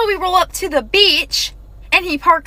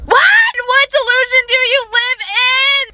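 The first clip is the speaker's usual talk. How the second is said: incredulous shout; 7 semitones higher than usual. Real, not acted. Mocking his creepy line to viewers, an incredulous shout. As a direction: shout it at him, floored by his nerve.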